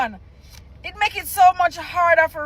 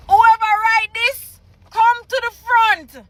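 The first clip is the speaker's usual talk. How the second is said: higher, louder mock pastor summoning the culprit; hammed-up preacher call; 7 semitones higher, 4 dB louder than usual. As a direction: call the writer up to the altar, playing it big. Repeats the summons bigger.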